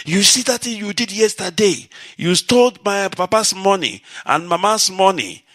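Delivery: high, loud accusing yell at a sibling; screaming at sister. Retold or imitated. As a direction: scream it out across the market to shame her. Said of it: "I begin to scream on top of my voice".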